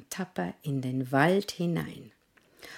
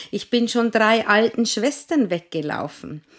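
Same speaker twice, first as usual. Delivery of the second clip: rufend; 6 semitones higher, 6 dB louder than usual. Acted. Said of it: "rief der Pfannkuchen".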